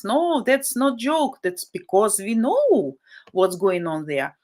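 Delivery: ordinary delivery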